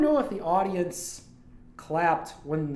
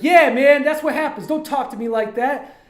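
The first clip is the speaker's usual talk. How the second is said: loud, raised retold telling-off; acted angry warning; 8 semitones higher, 10 dB louder than usual. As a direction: imitate a fight winner laying down the law. Acts streamer warning the beaten man.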